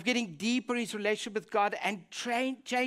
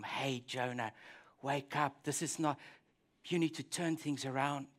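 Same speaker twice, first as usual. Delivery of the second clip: gentle call; 7 semitones lower, 6 dB quieter than usual. Retold or imitated. God gently calling Jonah.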